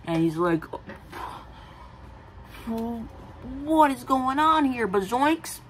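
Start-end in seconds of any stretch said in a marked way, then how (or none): 2.6-5.6 high, slow cartoonish panic; silly high cartoon voice; goofy teen voice. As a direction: ham up the confused kid. Goofy Richard voice, then disowned.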